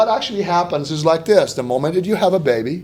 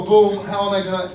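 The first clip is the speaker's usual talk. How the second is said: usual speaking voice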